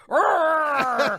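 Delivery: high loud growl; a big alarmed growl; mechanic growl. Shown as a demonstration. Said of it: "Here's one more".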